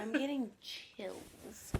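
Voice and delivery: slow, hushed mock shiver; mock spooked. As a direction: pretend to be spooked, laughing.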